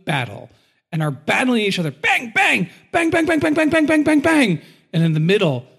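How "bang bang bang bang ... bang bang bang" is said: high, loud mock gunfire bangs; gunshot noises. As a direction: blast out the bangs like a shootout. Mimics a gun battle.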